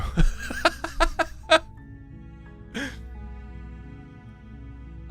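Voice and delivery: airy laugh; laughing hard, no voice; breathy laugh. Real, not acted. Laughs after joke.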